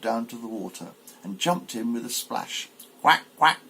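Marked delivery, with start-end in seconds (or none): 3.0-3.6 quack. Acted. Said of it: "cried she".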